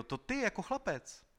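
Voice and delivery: very high, loud, amazed question; astonished cry. A narrator's reading. Klorindo seeing Konos as a boy, crying out. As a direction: exclaim in disbelief. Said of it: "Zvolal Klorindo".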